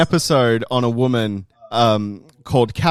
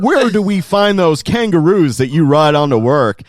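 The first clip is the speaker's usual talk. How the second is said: loud, high, wide-eyed American tourist; American tourist impression; 4 semitones higher, 6 dB louder than usual. Australian mocking a tourist's naive question in an American accent. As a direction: play a naive gushing American. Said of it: "they'll be like".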